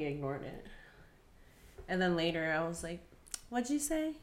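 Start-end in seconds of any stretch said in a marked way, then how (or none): 3.5-4.1 high, sweetly curious question; retold sudden interest, pitched well up; belated double take. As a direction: ask it high and light. Retelling her belated curious question.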